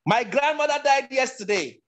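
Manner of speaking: very high, sustained pitch; high-pitched demonstration line, held up high. Shown as a demonstration. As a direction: say it way up in your high range. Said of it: "obviously that's high pitch".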